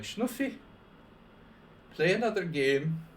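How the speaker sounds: high, loud imitation of a Serbian accent; weak Serbian accent. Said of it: "my Serbian accent's a little weak".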